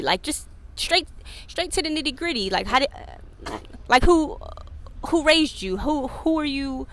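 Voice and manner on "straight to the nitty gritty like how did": ordinary talk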